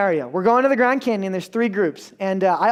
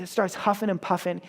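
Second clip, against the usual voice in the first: ordinary talk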